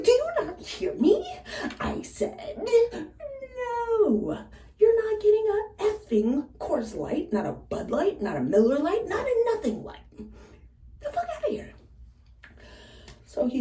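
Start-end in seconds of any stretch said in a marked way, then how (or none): 0.0-11.7 high, loud, furious refusal with the words drawn out; exasperated yell. Retold or imitated. Bartender yelling at a pushy regular, high and loud. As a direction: let him have it, fed up and done.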